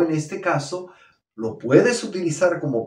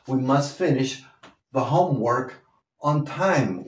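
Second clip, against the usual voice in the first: normal talking voice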